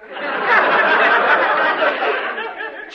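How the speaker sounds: long high-pitched giggle; a giddy high cackle; high giggling. Acted. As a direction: laugh high and long, delighted. Long laugh at joke.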